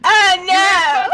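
high, drawn-out mock wail; mock despair. Joining a friend's fake meltdown, high mock wail. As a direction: stretch it into a fake lament.

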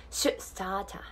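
"start a" as "stah-ta", clear T; slow model of "start a" without R; Australian pronunciation. Shown as a demonstration. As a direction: stop the T in "start a", open final "ah". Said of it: "こんな感じになります。".

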